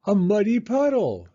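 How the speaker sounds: high, loud delighted cry; excited shout. A narrator's reading. Little girls spotting a muddy puddle, high excited shout. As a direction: shout it with pure joy.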